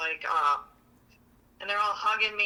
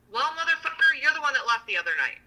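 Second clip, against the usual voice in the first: louder, higher, fast jab; angry rant; 6 semitones higher, 3 dB louder than usual. Real, not acted. Ranting to a friend about her partner, angry and fast. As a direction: tell him off, fast and sharp.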